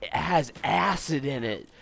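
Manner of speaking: slow, lightly creepy reveal; creepy friend. Retold or imitated. Friend reveals what was in the cereal, slow creepy voice. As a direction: draw it out like a creep sharing a secret.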